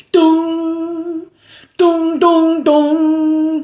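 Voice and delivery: piano dong imitation. Reviewer mimics the movie's piano score, sung dongs. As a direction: hum each dong like a plodding piano key. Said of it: "this fucking piano going on".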